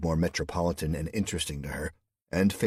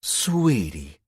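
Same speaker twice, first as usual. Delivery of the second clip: sarcastic pet name, a bit louder; 3 semitones higher, 6 dB louder than usual. A narrator's reading. Woman answers his awkward question with a mildly sarcastic pet name. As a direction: lay on light sarcasm. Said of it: "she said sarcastically".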